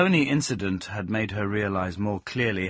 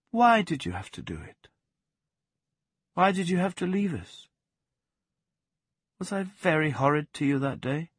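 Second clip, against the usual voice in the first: put-on higher, hurt young woman's voice; pained questioning; 8 semitones higher than usual. Plaxi asking the dog why he left them. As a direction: ask why, hurt and pleading.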